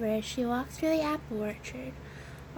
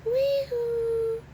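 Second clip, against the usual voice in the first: high, drawn-out distant holler; high-pitched storybook yell; 9 semitones higher, 4 dB louder than usual. A narrator's reading. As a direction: whoop it out in a high, sustained call. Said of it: "she heard someone yell".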